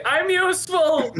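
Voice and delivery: high, slow muffled little voice; muffled sword voice. Acted. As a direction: squeak it out from inside, muffled. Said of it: "a muffled voice say".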